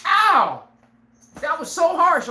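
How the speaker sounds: mock hurt whine. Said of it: "you sitting there like".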